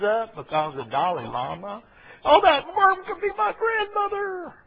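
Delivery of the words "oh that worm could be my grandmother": high, put-on fretful voice; hammed-up alarm over a worm; mock worried believer.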